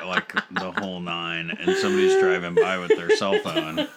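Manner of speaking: high-pitched laughter through words; laughing. Real, not acted. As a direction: squeal with laughter mid-story. Laughing while telling story.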